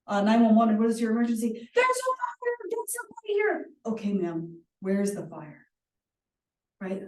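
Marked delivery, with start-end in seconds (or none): 1.7-3.7 much higher, frantic mock caller; panicked caller. Acting a panicked 911 caller. 3.9-5.7 slow, even, reassuring dispatcher; calm dispatcher. Acted.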